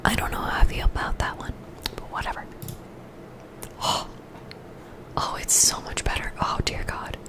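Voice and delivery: soft close whisper; ASMR whisper. Real, not acted. Streamer whispering through earbud trouble to chat.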